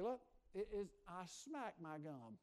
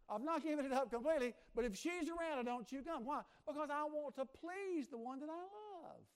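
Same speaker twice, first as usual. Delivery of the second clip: louder, higher emphatic preaching; full-force preaching; 9 semitones higher, 7 dB louder than usual. Acted. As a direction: drive the point home about pleasing the one you love.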